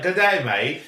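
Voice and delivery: jokey Australian "Good day, mate"; Australian accent. Acted. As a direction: do a playful Aussie accent. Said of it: "coming back from Australia with Australian accent".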